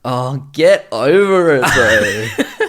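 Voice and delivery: hammed-up dismissive bro voice; mock bro. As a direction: brush it off like a bro.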